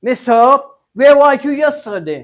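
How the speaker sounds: put-on Englishman's accent, slow and loud with the r dropped; English accent. Teacher voicing an Englishman, slow English accent. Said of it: "अगर अंग्रेज कहे".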